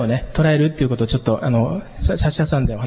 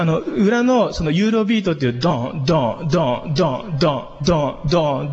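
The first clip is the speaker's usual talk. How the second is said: ordinary delivery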